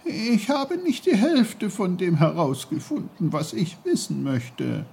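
put-on high-pitched, tearful child's complaint; nearly crying. A narrator's reading. Boy protests to uncle he hasn't learned enough, nearly crying. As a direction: complain high, close to tears, easing at the end. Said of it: "Peter weinte fast".

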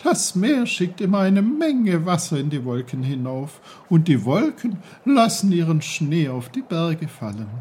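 high, loud, bubbly babbling voice; a merry stream calling out; cheerful brook. Acted. The brook greets Peter loudly and cheerfully.

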